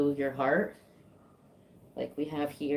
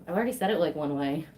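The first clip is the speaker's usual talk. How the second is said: normal talking voice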